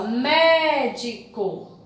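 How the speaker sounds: médico slow, long stressed mé then separate syllables. Shown as a demonstration. Teacher counting syllables for pupils, médico slowly split up. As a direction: pull médico apart, leaning on mé. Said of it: "Três sílabas".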